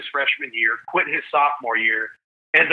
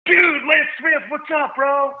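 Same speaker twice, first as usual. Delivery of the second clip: excited; 12 semitones higher, slower than usual. Retold or imitated. Greeting a TV host excitedly.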